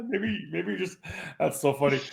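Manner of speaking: high laughter into words; laughing. Real, not acted. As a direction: talk through laughter. Cracks up at joke.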